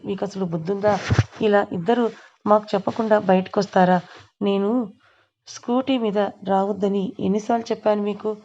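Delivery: ordinary delivery, nothing marked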